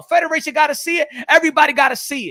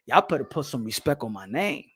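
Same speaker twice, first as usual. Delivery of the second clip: [name] impression; 11 semitones lower, 6 dB quieter than usual. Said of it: "I'm on that [name] shit".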